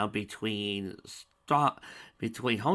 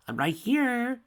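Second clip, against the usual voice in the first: mocking sing-song; 6 dB louder, slower than usual. Villain mocking Spike.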